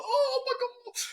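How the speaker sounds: high squeal of excitement with a laugh; thrilled disbelief. Retold or imitated. Taking the MasterChef call at work, squealing with delight. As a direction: gasp it out, giddy and laughing.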